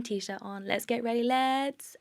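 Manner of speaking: put-on rising sing-song prompt, pausing for child; lightly voiced coaxing to a toddler; therapist voice. Shown as a demonstration. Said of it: "therapist voice".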